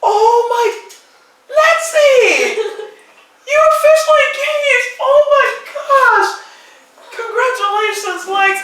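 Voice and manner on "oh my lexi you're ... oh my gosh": higher, slowed, calling out in joy; real excitement at the engagement; excited cheer. Real, not acted. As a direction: call her name out in delight.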